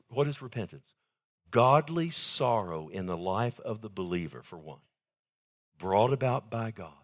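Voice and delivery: usual speaking voice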